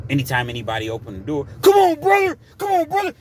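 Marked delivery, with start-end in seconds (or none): 1.6-3.1 very high, loud wrestler shout; Hulk Hogan. Retold or imitated. Big Will jumping up when the door opens, wrestler-style shouting. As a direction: challenge them like a pumped-up wrestler. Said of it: "he goes through his Hulk Hogan".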